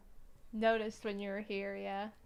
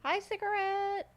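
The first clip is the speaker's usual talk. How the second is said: high, slow put-on voice; squeaky cartoon hello; silly high greeting; 11 semitones higher, 7 dB louder than usual.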